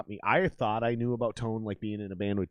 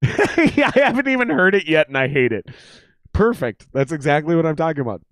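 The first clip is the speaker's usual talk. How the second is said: higher, louder laughing talk; laughing through it; 6 semitones higher, 10 dB louder than usual. Real, not acted. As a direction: laugh while making the point. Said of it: "Look at".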